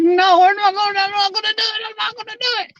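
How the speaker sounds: squeaky teasing imitation of the streamer, trailing off; mocking girly voice. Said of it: "She's like, she's like".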